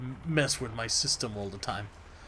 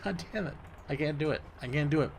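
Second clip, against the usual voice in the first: ordinary talk, nothing marked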